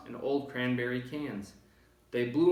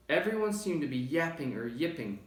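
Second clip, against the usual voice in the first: usual speaking voice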